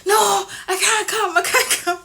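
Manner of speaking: high, loud, laughing plea to get through; frantic pleading. Retold or imitated. Fan begging staff to let her through, frantic and laughing. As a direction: beg the staff, breathless and giddy.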